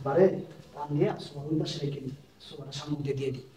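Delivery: very low and soft, conspiratorial; hushed plotting. Acted. Husband to wife about hiding someone behind the sofa, hushed. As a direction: share a secret plan under your breath.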